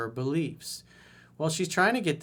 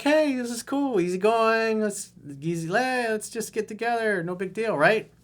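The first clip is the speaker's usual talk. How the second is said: high, breezy mock laid-back dude; easygoing guy; 9 semitones higher, 4 dB louder than usual. Coach voicing the relaxed guy's attitude, high and breezy. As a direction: toss it off casually, hammed up. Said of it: "his demeanor is like".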